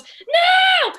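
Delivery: high, loud little-brother protest; Billy. Retold or imitated. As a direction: shout it shrilly high, stretched out like a defiant kid. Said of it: "in his Billy voice again".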